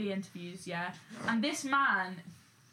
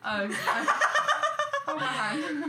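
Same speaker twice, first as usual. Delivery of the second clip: mock flustered; 8 semitones higher, 4 dB louder, slower than usual. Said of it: "come up to me and say".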